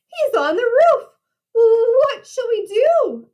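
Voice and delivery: high, frightened pig voice; panicked. A narrator's reading. Pigs hear the wolf on the roof, high panic. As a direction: pitch it up and stretch the question out in dread.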